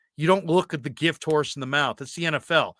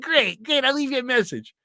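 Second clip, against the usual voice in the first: high, shouted gushing voice; excited Ralph; 10 semitones higher than usual. Retold or imitated. Coworker hearing about Disneyland trip, high excited gushing. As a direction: gush about Disneyland, pitched way up. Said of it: "He said".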